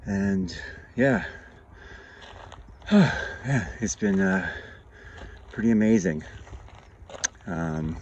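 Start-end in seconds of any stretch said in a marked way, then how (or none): 2.9-3.4 sigh. Real, not acted. Out of breath on trail.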